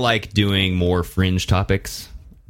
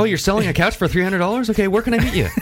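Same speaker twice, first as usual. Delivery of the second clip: much higher, giddy small-talk voice; high goofy friendly voice; 12 semitones higher than usual. Retold or imitated. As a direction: play an overly chummy stranger on a call. Imitating Chris chatting with strangers.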